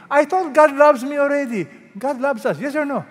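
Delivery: high, loud mock protest; puzzled objection. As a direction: protest like a baffled listener. Voicing a listener's puzzled objection.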